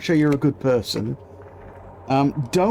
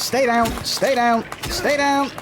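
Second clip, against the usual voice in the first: each stay down pleads higher and higher; urgent pleading; 8 semitones higher than usual. Real, not acted. Player pleads with the shot monster, rising higher each time. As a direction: beg the monster to stay down, climbing in pitch.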